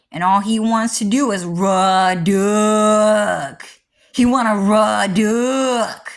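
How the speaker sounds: slow, drawled mock voice of jail talk; imitating how fellow inmates said it, drawn out; 1 jail slang. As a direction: stretch out "raw duck" as if imitating inmates. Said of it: "That's how they said it while I was in jail".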